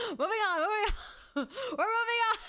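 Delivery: loud, very high, cracking-up voice; laughing hard. Real, not acted. Streamer trying to move past own joke, laughing loudly. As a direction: push the words out through real laughter.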